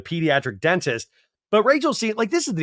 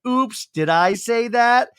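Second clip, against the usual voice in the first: high, slow, fake-innocent kid voice; innocent little girl; 8 semitones higher, 4 dB louder, slower than usual. Daughter caught cursing, slow high mock-innocent voice. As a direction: play coy like a caught child. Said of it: "the daughter looks over like".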